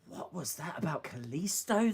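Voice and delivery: usual speaking voice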